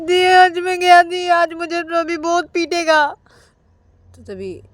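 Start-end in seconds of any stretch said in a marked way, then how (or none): 0.0-3.0 high, loud, slow whine; whining wail. Acted. Brother wailing to sister, high slow whine. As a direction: moan in dread of a beating. Rahul wails in fear.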